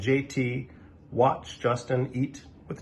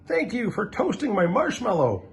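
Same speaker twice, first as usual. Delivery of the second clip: a friendly dragon, lightly voiced higher; a grateful little dragon, pitched higher; polite dragon; 10 semitones higher than usual. Drawn dragon speaking.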